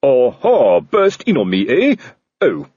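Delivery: loud, gloating Aha that fizzles out; evil triumph. Acted. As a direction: pounce on the intruder in triumph. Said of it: "Colon to jump up in evil triumph".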